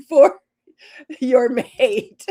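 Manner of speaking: high laugh spilling into the words. Real, not acted. Joking to viewers about their mates, laughing. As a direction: giggle through the punchline.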